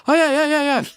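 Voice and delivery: high, quick string of agreement; imitated woman. Woman answering the comic about getting paid, imitated high voice. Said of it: "She goes".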